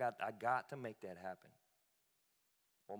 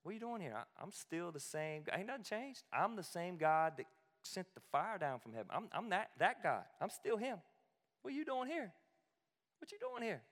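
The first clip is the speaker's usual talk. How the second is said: higher, gentle fatherly voice; loving God's voice; 7 semitones higher than usual. Acted. Preacher voicing God as loving father, higher and tender. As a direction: speak as a good daddy who wants to meet. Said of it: "God is like".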